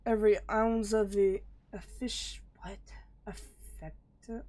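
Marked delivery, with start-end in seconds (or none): 1.7-2.4 whisper. Real, not acted. Stumbling reading whispered. 2.6-4.4 breathless whispered try at the word; barely voiced, puzzling over it. Real, not acted. Whispering a strange word to herself, unvoiced.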